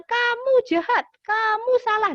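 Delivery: high, loud scolding outburst; acted angry accusation. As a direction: lash out accusingly, pitch shooting up. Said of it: "Jadi sebelum kita bilang". (bentakan menuduh dengan nada tinggi)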